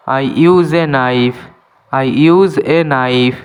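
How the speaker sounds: slow, clear English for learners; careful dictation pace; slow teaching demo. Shown as a demonstration. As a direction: spell each word out slowly for students. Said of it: "এখানে কে silent".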